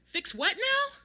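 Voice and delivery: high, loud baffled question; loud incredulous shout back. A narrator's reading. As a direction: holler back, rising in disbelief. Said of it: "in a similarly loud voice".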